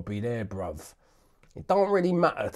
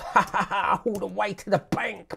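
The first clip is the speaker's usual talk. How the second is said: higher, chuckling through the joke; laughing; 8 semitones higher than usual. Real, not acted. Laughing joke about money.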